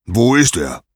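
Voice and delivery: forceful, impatient shout; thundering. Acted. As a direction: boom the question like an angry god. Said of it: "donnerte Zeus".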